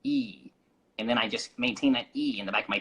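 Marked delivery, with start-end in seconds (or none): 0.0-0.5 high, bright, pingy "E"; twangy, pingy "E" sound; bright nasal E. Shown as a demonstration. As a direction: hold the "E" in the back of the throat. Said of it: "if I go like ... I got a little nasally".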